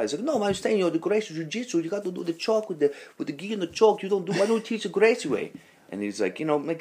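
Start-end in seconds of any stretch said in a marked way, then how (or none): 0.2-5.5 high-pitched imitation of an insistent student; mock objection, pitched well up; retold protest. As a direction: argue back in a raised, pestering voice. Student arguing back in retold quarrel.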